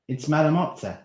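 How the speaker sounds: insistent. Acted. Darren reveals spider.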